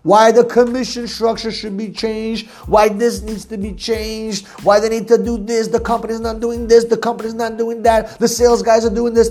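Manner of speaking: droning singsong list of gripes on one held pitch; mock complaining. Sales coach imitating salespeople who complain about everything, droning mock complaint. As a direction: imitate an endless whine, each gripe on the same note.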